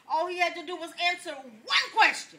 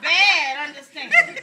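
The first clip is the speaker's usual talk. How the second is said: loud, slow "Bad understanding" through laughter; laughing punchline; 12 dB louder, slower than usual. Real, not acted. Preacher closing the joke to his audience, laughing. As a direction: laugh out the catchphrase. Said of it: "catchphrase again".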